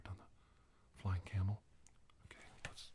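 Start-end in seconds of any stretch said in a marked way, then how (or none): 2.3-2.9 hushed, getting on with it; whispered aside. Real, not acted. Host moving to the next card, whispered. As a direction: whisper to yourself.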